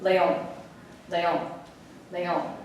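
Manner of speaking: Leon as le-ON, each time separately; stress on last syllable. Shown as a demonstration. Teacher repeats the word for students, final-syllable stress in Leon. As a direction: repeat Leon softly, leaning on the ON. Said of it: "It's on the last syllable".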